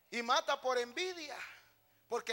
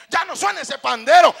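very loud, raised-pitch complaint; acted scolding shout; 7 semitones higher, 24 dB louder than usual. Annoyed churchgoer to the tambourine player, mock scolding shout. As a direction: order the player to stop.

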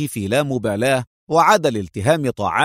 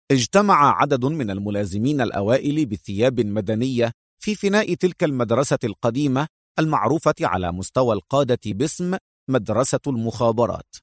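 ordinary delivery

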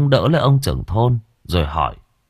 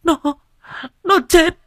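sobbing, much higher and louder, choking on the words; 8 dB louder than usual. Acted. Wife to husband, sobbing out that their son died. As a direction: wail it out through sobs, voice breaking. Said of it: "vừa khóc vừa nói trong tiếng nấc nghẹn ngào".

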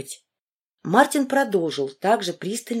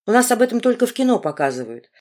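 usual speaking voice

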